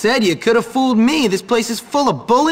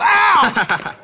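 sharp ow of pain; 9 semitones higher than usual. Real, not acted. Hit by bully.

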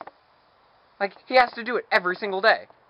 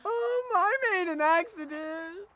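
mock crying; 13 semitones higher, slower than usual. Hair mishap, fake crying.

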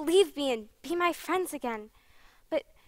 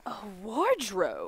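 puzzled repeat; 8 semitones lower, 7 dB quieter than usual. Acted.

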